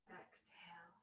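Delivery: breathy whisper, no voice; whispered exhale. Real, not acted. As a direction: breathe the word out softly. Breath cue while exhaling.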